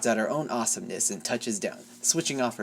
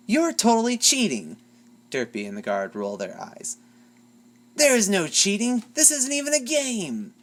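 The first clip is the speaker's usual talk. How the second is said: bickering; 8 semitones higher than usual. A narrator's reading. Childish quarrel over fairness.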